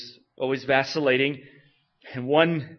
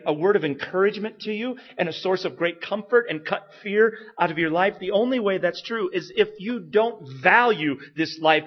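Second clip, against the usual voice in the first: loud, high, impassioned preaching; full-force preaching; 7 semitones higher, 6 dB louder than usual. Acted. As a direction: drive the point home with fire.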